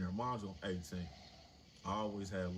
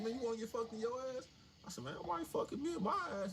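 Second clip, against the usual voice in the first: much higher, fast mock exchange; hammed-up back and forth; faster than usual. Guard and new inmate trading lines, high and fast.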